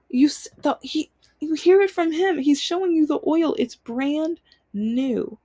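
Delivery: retold exasperated protest. Daughter pointing dad to the mechanic's proof, retold exasperated protest. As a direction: replay an exasperated argument up high, stretching the ending.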